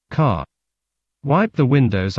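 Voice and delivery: ordinary delivery, nothing marked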